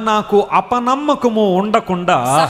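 drawn-out, slow repeat of the verse; slow preaching echo. Acted. Preacher slowly echoing the verse to his congregation. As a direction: stretch each word of the verse out slowly.

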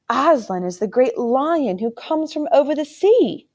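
put-on a child amazed anyone wouldn't know; babbling on. A narrator's reading. Caspian babbling to his uncle, innocently surprised. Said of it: "But he babbled on".

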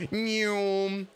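high, drawn-out "nyoom" sound effect; imitated zoom noise. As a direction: swoosh it like something zipping past. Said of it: "and I went".

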